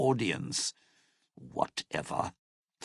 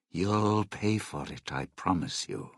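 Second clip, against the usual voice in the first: low threat, dropping away at the end; 6 semitones lower than usual. Acted. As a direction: lower the voice into a cold promise. Threatens partner against betrayal.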